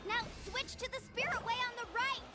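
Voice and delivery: high urgent shout across the water; shouted command mid-jump; calling out. Acted. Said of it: "Paimon heard Mualani shouting just now!".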